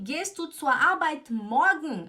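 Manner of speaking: loud, high, slow German with MORGEN moved to the end; word-order demo. Shown as a demonstration. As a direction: carefully tack morgen on after Arbeit. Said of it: "Eu posso falar assim?".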